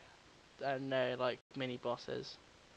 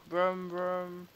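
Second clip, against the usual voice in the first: engine noise; 4 semitones higher, 4 dB louder than usual. Pretend. Driving noise imitation.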